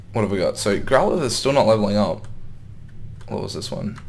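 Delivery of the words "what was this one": normal talking voice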